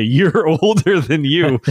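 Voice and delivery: real laughter through the words; cracking up mid-sentence; laughing while talking. Real, not acted. Laughing at son's claim.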